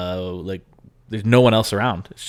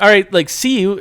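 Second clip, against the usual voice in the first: high, louder, quick brush-off; awkward goodbye; 10 semitones higher, 5 dB louder than usual. Retold or imitated. Dad awkwardly saying goodbye to the girl, high brush-off. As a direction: get out of there politely.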